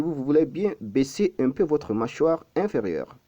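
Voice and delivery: ordinary talk, nothing marked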